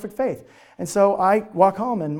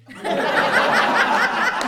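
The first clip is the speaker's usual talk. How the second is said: high breathless laugh; 6 dB louder than usual. Real, not acted. Punchline of proposal story.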